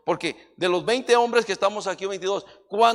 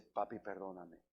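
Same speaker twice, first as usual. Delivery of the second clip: wife apologizing; 9 semitones lower, 19 dB quieter than usual. Retold or imitated. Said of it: "luego me dice".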